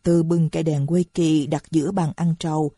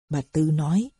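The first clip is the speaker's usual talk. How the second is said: normal talking voice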